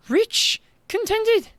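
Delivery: put-on high, disbelieving young girl; girl repeating the mayor's words in disbelief; incredulous girl. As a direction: throw his words back at him, high.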